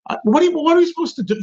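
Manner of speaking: high, fast, worked-up burst of disbelief; exasperated rant. Real, not acted. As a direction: fire off the question in exasperation. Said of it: "here's another rant".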